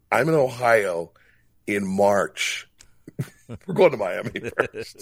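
mock-eager, laughing through the words; laughing self-quote. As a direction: replay your younger self, giggling at the obvious choice. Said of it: "And I'm like".

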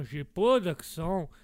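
put-on loud, slow, heavy Québécois accent. Québécois guest cuts the host off, slow thick Québécois accent. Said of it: "qu'on parle de votre accent".

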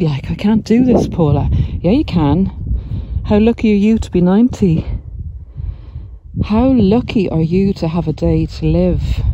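ordinary talk, nothing marked

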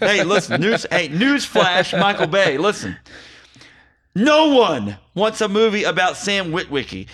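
loud, high comic rant, shouting. Acted. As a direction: rail at Michael Bay for laughs. Mock rant at Michael Bay.

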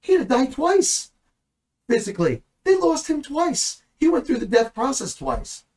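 fervent emphatic preaching. Real, not acted. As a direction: hit each twice hard. Preacher hammers home the point.

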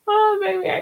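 squeaky high mock outrage on "Oh"; mock angry. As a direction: playfully fake being mad.

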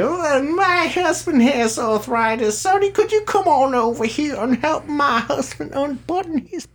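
high-pitched mock old lady asking for help; old customer's wife. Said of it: "that's what they always say".

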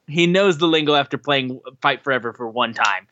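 ordinary talk, nothing marked

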